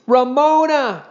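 sudden high, loud shriek of a name. A narrator's reading. Beezus shrieks at her little sister, high and loud. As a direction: shriek it out in alarm. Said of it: "shrieked Beezus suddenly".

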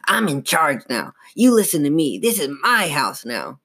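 domineering evil dummy voice; menacing, swooping character voice; grumbling snarl. A narrator's reading. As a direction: snarl it like a puppet taking over. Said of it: "grumble of a voice".

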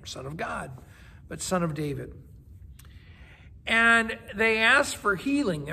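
ordinary talk, nothing marked

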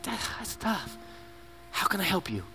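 quiet, gentle concern; soft validation. Retold or imitated. Parent comforting a struggling teenager gently. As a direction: comfort the teen softly.

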